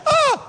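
sharp, very high cry of alarm; startled parent's yelp, put on; acted alarmed gasp. Shown as a demonstration. Parent reacting to child's fall, mock alarmed cry. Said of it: "And if you react".

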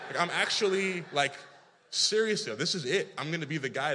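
loud, frantic, sure he'll die; panicked. Retold or imitated. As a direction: voice jumps high in panic. Panicked recall of near drowning.

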